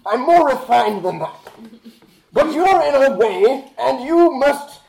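high, loud theatrical indignation; haughty adventurer. Acted. Adventurer telling merchant he's no thug, hammed-up haughty menace. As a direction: declaim it with affected refinement, pausing before the threat. Player voices character answering merchant.

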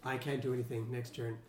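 low, soft deadpan; resigned deadpan, dropped low and quiet; stone-faced. Shown as a demonstration. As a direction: say it without any reaction. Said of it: "be stone-faced and go".

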